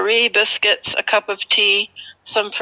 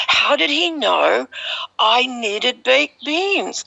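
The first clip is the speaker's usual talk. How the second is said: much higher, wondering, slowing at the end; astonished; 8 semitones higher than usual. Retold or imitated. Wondering how Swami knew about the beans, astonished. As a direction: marvel in disbelief.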